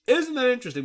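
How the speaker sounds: a high sarcastic echo. Acted. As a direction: press the sarcasm home. Sarcastic repeated remark.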